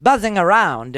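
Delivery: lightly mimicked old movie-star diction, drawn out; a hint of forties mid-Atlantic movie accent; Bette Davis. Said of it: "why does she talk like Betty Davis".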